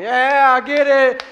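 high, loud congregation cheer. Acted. Congregation member cheering on a voiceless pastor, loud hype shout. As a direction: shout it like you're covering for the preacher. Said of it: "the more loud you are".

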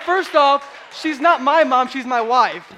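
hammed-up indignant dad setting it straight; indignant retort. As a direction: shout the correction in mock offence. Mock indignant retort to daughter.

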